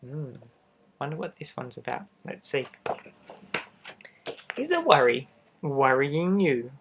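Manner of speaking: ordinary delivery, nothing marked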